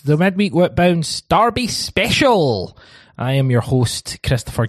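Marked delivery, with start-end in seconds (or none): 0.0-2.8 slow, high, drawn-out show opener; announcer voice. Acted. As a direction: welcome them like a game show host. Host opens show announcer-style.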